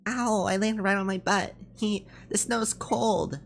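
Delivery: high, playful cartoon-like voice; cheerful character. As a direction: play a carefree character tumbling in snow. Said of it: "It must be nice to be so cheerful".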